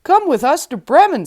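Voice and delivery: donkey inviting the cat, loud and eager; donkey calling out. A narrator's reading. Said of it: "said the donkey".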